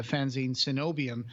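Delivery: ordinary delivery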